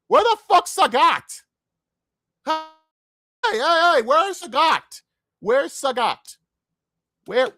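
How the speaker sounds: a real outraged fan calling out; indignant shouting. Real, not acted. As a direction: yell for the missing character like he was stolen from you. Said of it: "I'm actually now annoyed".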